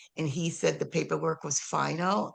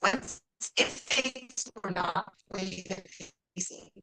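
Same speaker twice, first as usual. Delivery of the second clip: muffled, as if behind a hand; 2 semitones higher, 5 dB quieter than usual. Real, not acted. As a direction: speak through a covering hand. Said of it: "you muffled just a little bit".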